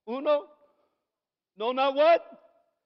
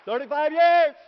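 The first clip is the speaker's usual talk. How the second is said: triumphant shout; 5 semitones higher, 10 dB louder than usual. Real, not acted. Preacher telling congregation his saved years, triumphant shout. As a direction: belt it out, loud and proud.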